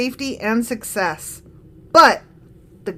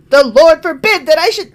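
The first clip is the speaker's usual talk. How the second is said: wrong voice; 5 semitones higher, 6 dB louder than usual. Acted. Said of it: "I did the wrong voice".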